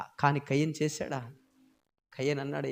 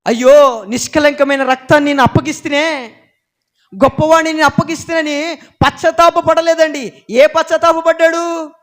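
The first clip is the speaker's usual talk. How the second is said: louder, higher pulpit preaching; fiery preaching; 12 semitones higher, 16 dB louder than usual. Acted. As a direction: drive it home to the congregation. Preaching at full force.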